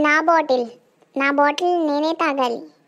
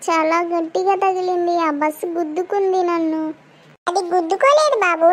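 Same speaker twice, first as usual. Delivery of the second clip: ordinary talk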